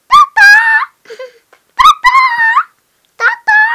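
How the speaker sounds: very high, loud squeal; squealed baby call. Pretend. Child as ti bebe calling tata, high squeal. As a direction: call out in a piercing pretend-baby voice.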